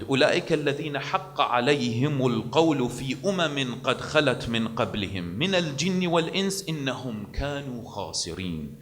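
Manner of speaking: fast, soft melodic recitation; Quran recitation. Acted. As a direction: recite the verse swiftly.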